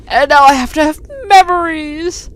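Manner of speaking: high-pitched, drawn-out melodramatic wail; mock anguish. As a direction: play up the tortured hero. Voicing hero's traumatic flashback.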